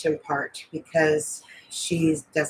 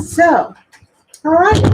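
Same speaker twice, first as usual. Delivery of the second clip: upbeat high call; 10 semitones higher, 5 dB louder, slower than usual. Real, not acted. Rallying before moving on.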